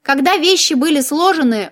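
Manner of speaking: plain reading voice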